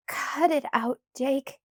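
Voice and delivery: angry. A narrator's reading. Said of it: "I said angrily".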